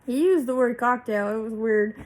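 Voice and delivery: loud amused disbelief. Real, not acted. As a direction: burst out in disbelief.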